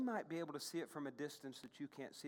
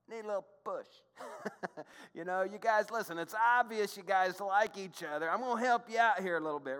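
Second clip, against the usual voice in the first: higher, louder mock coaxing; playful matchmaker; 4 semitones higher, 8 dB louder than usual. Matchmaker to the two who like each other, playful coaxing. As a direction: nudge the pair along, playfully loud. Voices Naomi nudging the shy couple.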